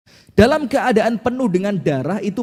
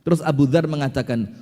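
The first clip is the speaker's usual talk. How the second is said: ordinary talk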